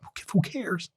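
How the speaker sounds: a soft throwaway first try; quiet. Real, not acted. Repeats rhetorical line.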